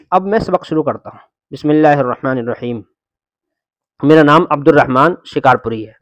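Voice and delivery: ordinary delivery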